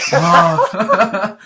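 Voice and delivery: loud, high moan of fake ecstasy; exaggerated pleasure moan, half laughing; mock orgasmic moan. Host teasing guest about French vanilla, mock ecstatic moan. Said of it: "sounded like he was having an orgasm".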